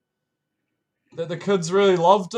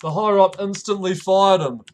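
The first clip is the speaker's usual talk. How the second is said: ordinary talk, nothing marked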